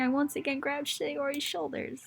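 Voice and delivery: ordinary talk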